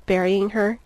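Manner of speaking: usual speaking voice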